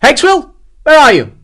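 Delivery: high, loud urgent call; shouted search. A narrator's reading. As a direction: yell for your missing sergeant. Captain shouts for missing sergeant.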